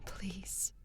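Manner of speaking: breathless whispered please; hushed begging whisper. Acted. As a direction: whisper it as a desperate prayer. Desperate whispered prayer.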